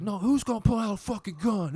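high, loud scoffing challenge; aggressive retort. Retold or imitated. Gang members challenge the teenagers, high and loud. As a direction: throw it back at them like a threat.

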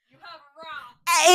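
loud slow sibling squabble; overlapping shrill shouting match; shrill overlapping reality fight. Real, not acted.

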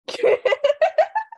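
squealing laugh, pitch jumping way up; can't hold the laugh in; high burst of laughter. Real, not acted. Laughing at her friend's comment, a high squealing laugh.

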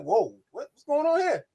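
amused, retold bewilderment rising high; taken aback. Friend retelling his reaction to her soft hello, taken aback.

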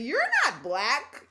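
slow, loud, put-on girl voice; imitating a dismissive classmate. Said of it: "She's like".

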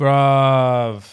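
loud, drawn-out exclamation; emphatic agreement. Real, not acted. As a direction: back up a friend's complaint loudly. Emphatic agreement with friend's complaint.

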